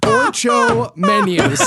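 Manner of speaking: "Orchomenus" said slowly, "or-CHO-men-us" with a laugh; "Orchomenus" drawn out high and slow; mispronounced name. Real, not acted. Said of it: "look up some pronunciations".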